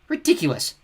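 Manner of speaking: scornful spat word. Acted. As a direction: spit it with contempt. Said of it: "the goddess spat".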